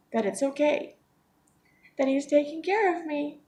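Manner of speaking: high, breaking voice with teary little laughs; smiling through real tears; tearful. Real, not acted. Trusting God like her grandpa's care, tearful and breaking. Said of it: "I didn't think I would get emotional".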